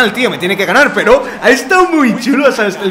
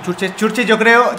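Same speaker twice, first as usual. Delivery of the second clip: ordinary talk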